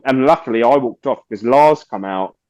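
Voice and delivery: ordinary talk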